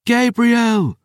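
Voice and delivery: very high, drawn-out call; distant cry. A narrator's reading. Rescuer calling to the narrator in the night, high cry. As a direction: cry the name out across the night. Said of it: "The cry echoed in the night".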